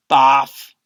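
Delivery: gross-out aside; comic barf. A narrator's reading. Disgust sound effect.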